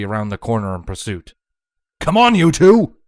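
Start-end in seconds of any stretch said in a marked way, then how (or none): 2.0-3.0 loud, higher rallying call; shouted. Acted. Vlad shouts to the couple while chasing, loud and higher. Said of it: "Vlad shouted".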